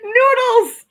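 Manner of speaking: high, loud, amused squeal of the name; delighted cry. Real, not acted. Vlogger squeals a viewer's funny name, high amused cry.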